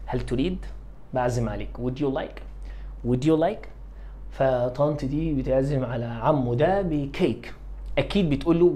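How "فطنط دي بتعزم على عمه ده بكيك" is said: ordinary talk, nothing marked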